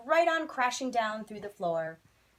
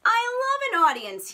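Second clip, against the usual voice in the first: much higher and louder, grandly thrilled; delighted maestro; 13 semitones higher, 10 dB louder than usual. Acted. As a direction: throw it out like a showman delighted by a crowd. Maestro greets falling crowd.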